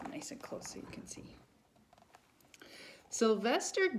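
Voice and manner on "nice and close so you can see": whispering. Real, not acted. Reader invites children closer.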